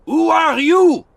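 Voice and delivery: high, loud and slow challenge; shouted. A narrator's reading. Frenchman shouts after the strangers, loud and high. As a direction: bellow a demand for names. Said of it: "shouted the Frenchman".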